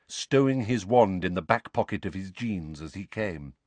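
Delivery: ordinary delivery, nothing marked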